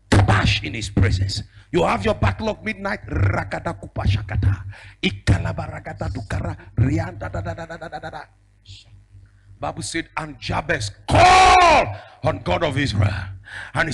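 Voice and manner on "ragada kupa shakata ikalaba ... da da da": speaking in tongues. Real, not acted. Praying in tongues.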